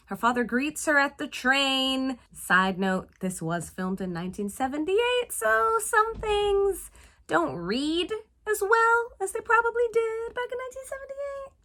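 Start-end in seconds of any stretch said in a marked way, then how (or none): 4.5-11.5 awkward high voice. Real, not acted. Host making an awkward side note on a dated film, high-pitched. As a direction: voice climbing far up into a high, held note.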